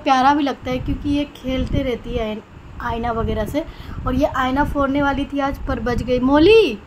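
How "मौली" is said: calling the bird's name loudly, falling at the end. Real, not acted. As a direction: call out to the bird across the room. Calling the pet bird. (चिड़िया को ज़ोर से पुकारना)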